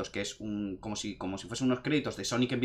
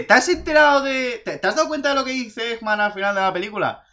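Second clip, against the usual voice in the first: louder, higher eager quoting of the uncle; excited question; 8 semitones higher, 5 dB louder than usual. Retold or imitated. Uncle asking after the cinema, excited and loud. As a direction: relay the uncle's eager nudge.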